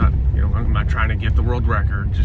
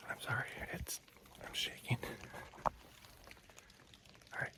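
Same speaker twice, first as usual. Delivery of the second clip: hushed, shaken whisper after the shot; hunter's whisper; 25 dB quieter than usual. Real, not acted. Hunter telling viewers the doe is down, shaking, whispered. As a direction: keep it whispered, rattled by adrenaline.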